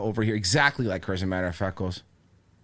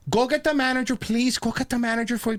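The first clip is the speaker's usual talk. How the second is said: high-pitched panicky plea; mock panic; 11 semitones higher than usual. Cashier sends bag woman for the manager, mock panic. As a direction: imitate a flustered cashier, pitch way up. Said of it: "to the panicking".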